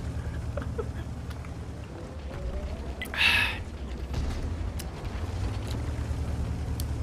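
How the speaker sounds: breathless quiet laughter; long wheezing laugh. Real, not acted. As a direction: laugh under your breath for a while. Amused by own story. (숨넘어가게 킥킥 웃음)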